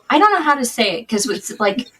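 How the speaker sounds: ordinary delivery, nothing marked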